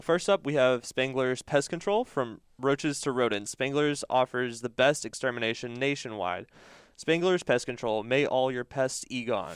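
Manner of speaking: ad read. Acted. Reads sponsor ad.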